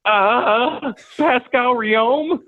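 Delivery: high, slow sheepish guess; nervous guess. Retold or imitated. Nervously blaming a teammate.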